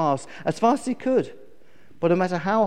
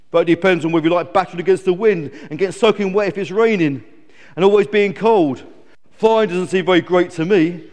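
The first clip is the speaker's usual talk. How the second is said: loud, brisk grumbling eagle; lightly voiced grumpy eagle; moaning eagle; 3 semitones higher, 7 dB louder than usual. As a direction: list complaints to the fly.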